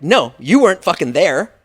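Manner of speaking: loud, heated comeback at a friend; arguing with a roommate who always disagrees, loud and exasperated; heated retort. Retold or imitated. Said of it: "And I'm like".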